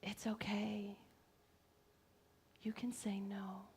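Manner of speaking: soft, half-whispered comfort; tender reassurance. Acted. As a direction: reassure her very gently, barely above a whisper. Therapist's reassurance voiced in story.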